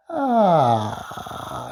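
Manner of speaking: creaky vocal fry ah. Shown as a demonstration. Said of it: "a little bit of vocal fry".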